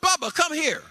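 put-on very high, loud little boy crying out; preacher imitating his grandson's delighted yell; excited toddler shout. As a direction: shout it like a thrilled three-year-old. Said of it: "He said".

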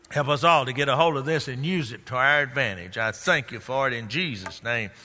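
low, softened pastoral prayer; spoken prayer. Real, not acted. Pastor asking Father's help for congregation, spoken prayer. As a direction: bring it down into a hushed closing prayer.

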